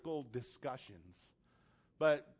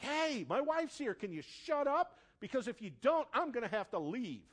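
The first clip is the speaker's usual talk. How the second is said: high-pitched, exasperated demand; irritated warning to a stranger; rude; 8 semitones higher than usual. Retold or imitated. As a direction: retell a rude confrontation, pitch raised. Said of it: "kind of rude about it".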